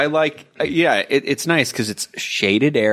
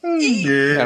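slow, high dolphin-like squeak; chirpy clicking dolphin; 7 semitones higher than usual. Acted. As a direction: stretch it out like a dolphin. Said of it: "Who can do the best dolphin voice here?".

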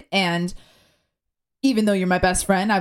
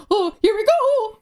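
high, warbling character voice, a touch louder; warbler character voice; 4 dB louder than usual. Retold or imitated. Co-host recalls playing the warbler in the session, high warbling voice. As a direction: sing it out like the warbler. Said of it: "the warbler".

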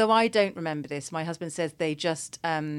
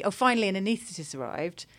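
usual speaking voice

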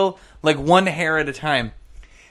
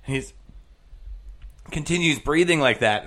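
ordinary talk

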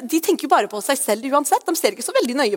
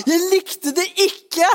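higher, slow, teasing heckle; mock heckler; 8 semitones higher, slower than usual. Preacher pretending a listener heckles, higher and slow. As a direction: act out a cheeky listener who hated it. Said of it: "hvis noen ropte ut".

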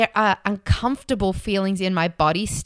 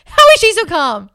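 much higher, louder incredulous question; amazed disbelief; 11 semitones higher, 11 dB louder than usual. Retold or imitated. Scared flyer amazed at her calm friend, loud disbelief. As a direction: marvel out loud, half shouting. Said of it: "I was like".

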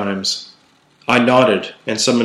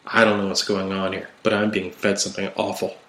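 soft, subdued confession; hushed; 2 semitones lower, 7 dB quieter than usual. Acted. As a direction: keep it quiet, almost under your breath. Continues whispered reply, rubbing jaw.

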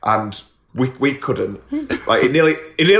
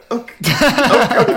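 mock flustered okay, pitched higher; flustered compliance; 9 semitones higher, 4 dB louder than usual. Told to park her car, mock meek okay. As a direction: give in quickly, meek and rattled. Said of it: "And I went".

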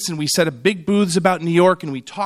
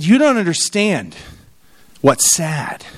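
a bit hard; slower than usual. Retold or imitated. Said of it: "I probably went a little hard".

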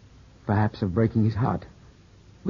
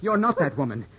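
higher, urgent begging; distressed pleading; 9 semitones higher than usual. Acted. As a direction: plead with her to stop, voice lifted high. Philip begs her to stop.

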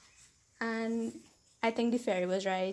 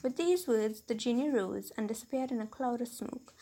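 measured storybook reading voice; story narration; 3 semitones higher than usual. A narrator's reading. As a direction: read it like a classic tale. Reads a fairy tale aloud.

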